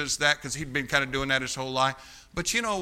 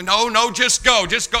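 raised, high-pitched retold dismissal; mock-irritated, louder brush-off; imitating mad husband; 6 semitones higher, 6 dB louder than usual. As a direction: wave them off angrily, as a retelling. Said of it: "He's mad at me".

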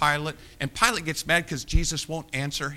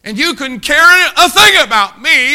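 much higher, much louder pleading cry; anguished cry; 13 semitones higher, 18 dB louder than usual. Acted. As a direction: cry it out as God wounded. God's voice, pleading climax.

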